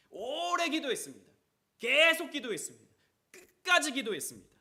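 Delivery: loud, high emphatic preaching; preaching build. Real, not acted. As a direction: drive each line harder. Preaching climax, repeated phrases.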